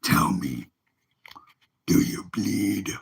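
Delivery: very low, slow vigilante voice; deep superhero impression; Ben Affleck's Batman. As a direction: drop way down, slow and menacing. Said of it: "good impression of Ben Affleck's Batman voice".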